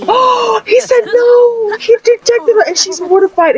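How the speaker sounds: very high, strained dismay; real shock. Real, not acted. Gamer seeing the proposal rejected, shocked shriek.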